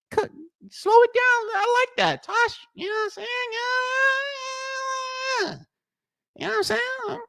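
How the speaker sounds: squeaky high put-on voice; cartoonish high-voiced tease; mock high voice. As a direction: pitch way up, teasing.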